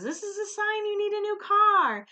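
imitating the dad. Retelling the dad telling Kristen she needs a car, high mimicry. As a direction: mimic the dad's advice in a high voice. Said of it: "[name] says".

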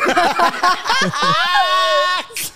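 big burst of real laughter; loud laughter. Real, not acted. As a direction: howl with laughter.

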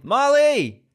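high, loud call of the name; excited shout. Real, not acted. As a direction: call her name with joy. Excited greeting.

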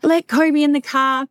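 mock yell from a nightmare about the dog; retold scream. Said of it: "And I'm screaming at Jessica".

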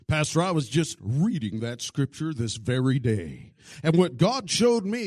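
loud, eager show-off answer; showy churchgoer. Retold or imitated. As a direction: show off to the pastor. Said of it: "the comment of all comments".

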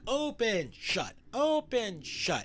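"Open" high and stretched, "shut" dropping after it; exaggerated open-shut rhythm. Shown as a demonstration. Showing how the haunted door didn't move, exaggerated slow rhythm. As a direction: sing out "open", "shut" in slow, swinging alternation. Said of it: "it wasn't like".

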